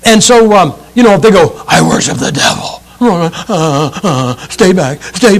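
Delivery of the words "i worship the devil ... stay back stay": mock the scary Satanist and the wimp who backs off; hushed creepy claim, then a shaky stay back.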